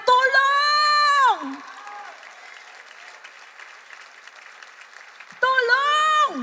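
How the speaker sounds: very high, long-held cry; re-enacted shout for help. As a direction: holler for rescue, stretching the word out. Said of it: "sumigaw po ako ng". (mahabang sigaw na humihingi ng saklolo)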